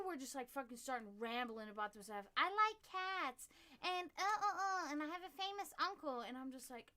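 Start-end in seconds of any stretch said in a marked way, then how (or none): 2.4-6.2 hammed-up, high-pitched eager chatter; mocking ramblers. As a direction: mimic an over-eager contestant showing off. Mocking rambling audition contestants.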